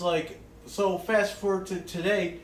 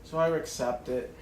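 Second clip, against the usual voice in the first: usual speaking voice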